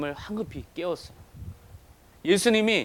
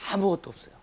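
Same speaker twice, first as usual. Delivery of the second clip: ordinary talk, nothing marked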